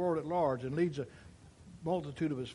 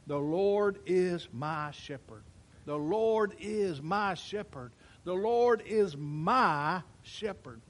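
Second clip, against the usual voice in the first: slow, weighty "The Lord is my shepherd", stress on Lord; bold declaration; 3 semitones higher, 5 dB louder, much slower than usual. Acted. Pastor joining David in declaring it boldly, slow and firm. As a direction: declare it slowly, three times, with conviction. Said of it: "boldly declaring".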